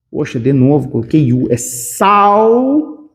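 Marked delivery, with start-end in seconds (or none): 1.6-3.1 "saudável" in very slow, separated syllables; exaggerated syllables. Shown as a demonstration. Teacher showing students how it is pronounced, long separated "saudável". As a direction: stretch each syllable of "saudável", final L as U. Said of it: "É assim que eu pronuncio".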